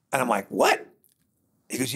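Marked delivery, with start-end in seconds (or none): 0.5-0.8 high-pitched incredulous reaction; surprised. Retold or imitated. Cyclist told storyteller he came from Chattanooga, retold surprise. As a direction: blurt it in disbelief, much higher. Retelling disbelief at Chattanooga answer.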